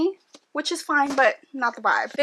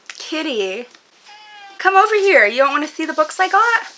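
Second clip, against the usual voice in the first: high, loud coaxing call; calling the cat; 7 semitones higher, 5 dB louder than usual. Real, not acted. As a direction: coax the cat over from afar.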